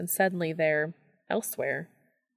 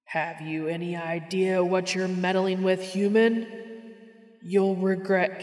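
booming voice. Acted. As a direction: threaten grandly from overhead. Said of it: "A voice booms from somewhere overhead".

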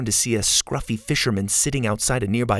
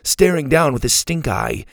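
plain reading voice

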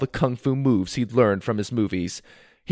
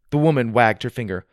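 ordinary delivery

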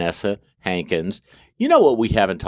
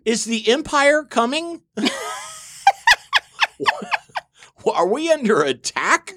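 put-on much higher, worried little kid; 11 semitones higher than usual. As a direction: gasp it like a scared child. Voicing kids seeing a stormtrooper.

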